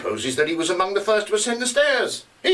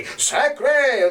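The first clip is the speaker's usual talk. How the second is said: gruff Frenchman; 9 semitones higher than usual. Retold or imitated. Retelling what the tailor heard, French oath. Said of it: "He, he heard distinctly".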